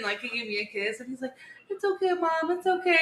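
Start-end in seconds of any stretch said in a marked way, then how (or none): 1.7-3.0 put-on high-pitched little kid soothing; imitated consoling son. Eli patting mom's back, imitated consoling child voice.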